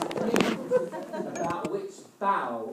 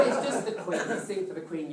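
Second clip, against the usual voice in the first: usual speaking voice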